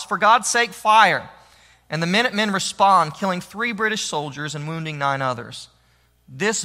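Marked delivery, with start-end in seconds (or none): 0.0-1.3 a retold battlefield cry, louder on fire; For God's sake, fire, barked out loud; shouted order. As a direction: call the volley like an officer. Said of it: "Major Buttrick of Concord shouts".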